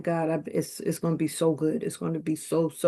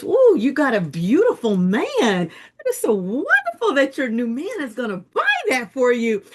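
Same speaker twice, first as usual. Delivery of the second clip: gushing saleswoman; 6 semitones higher, 6 dB louder than usual. Retold or imitated. Saleswoman gushing to the customer about her new man. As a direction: coo over the new man like a shop clerk. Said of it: "She says".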